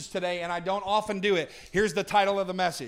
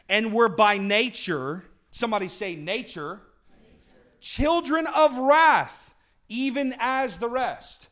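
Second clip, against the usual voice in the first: slow, loud scripture reading, building; full-force pulpit reading, drawn out; preaching scripture; 6 dB louder, slower than usual. Acted. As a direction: pound out each phrase to the congregation.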